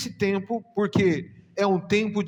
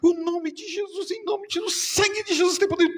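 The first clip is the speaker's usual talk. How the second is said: much higher, pleading call of Jesus' name; desperate cry to Jesus; 12 semitones higher than usual. Retold or imitated. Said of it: "Ela falou assim".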